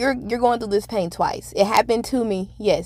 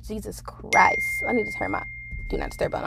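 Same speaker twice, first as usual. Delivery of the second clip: whispered aside. Real, not acted. Muttered aside mid-recording.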